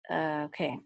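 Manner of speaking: normal talking voice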